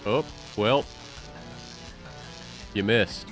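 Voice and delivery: normal talking voice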